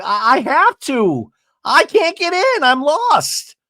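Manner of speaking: very high mock distress; retold helpless wail. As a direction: imitate someone lost and flustered. Acted lost and helpless.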